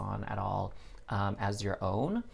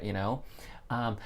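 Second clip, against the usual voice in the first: ordinary delivery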